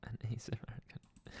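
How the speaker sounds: fast ASMR whisper; quick hushed whisper. Pretend. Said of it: "whispered aside".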